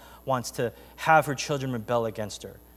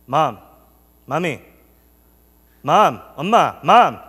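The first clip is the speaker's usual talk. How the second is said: building, louder calls for mom; anxious calling out; 8 dB louder, slower than usual. Retold or imitated. Child calling to silent mom in the car, insistent. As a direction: call for her repeatedly, growing more insistent.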